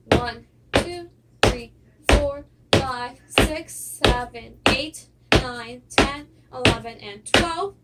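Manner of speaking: loud, firm step count. Shown as a demonstration. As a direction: punch each number out over the footwork.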